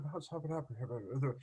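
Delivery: mock English clerk, barely moving the mouth; British accent. Shown as a demonstration. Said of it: "If you speak British".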